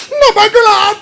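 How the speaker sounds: put-on impatient mother correcting a child; scolding mother. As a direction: play the strict mother drilling her kid. Mother drilling child with a ruler.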